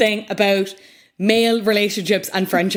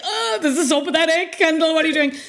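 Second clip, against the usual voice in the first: high, laughing mockery of Kendall; laughing scorn; 9 semitones higher, faster than usual. Laughing at the jacket while mocking Kendall's choices. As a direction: scoff through a laugh at how pathetic it is.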